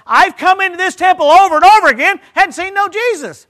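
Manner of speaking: high, full-volume yell of protest; complaining shout. Acted. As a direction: holler it out as a loud, exasperated complaint. Acted complaint in Simeon's place.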